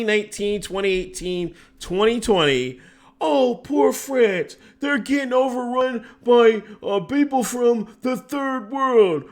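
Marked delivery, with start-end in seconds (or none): 3.2-9.2 high, slow mocking whine. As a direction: mimic their fake concern, high and drawn out. Mocking quote of people's complaint.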